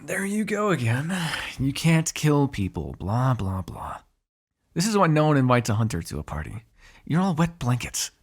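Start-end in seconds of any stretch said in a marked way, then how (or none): 0.0-4.0 mocking. Mocks hunter's objection to killing.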